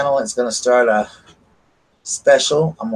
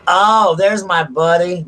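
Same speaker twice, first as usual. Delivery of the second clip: delighted greeting; 8 semitones higher, 5 dB louder, slower than usual. Real, not acted. Greets a viewer arriving.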